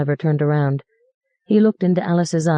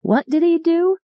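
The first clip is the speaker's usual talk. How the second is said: high shout over noise; child yelling over radio and horns; 13 semitones higher than usual. Acted. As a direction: yell to be heard above the din. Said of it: "Janie was yelling over all the noise".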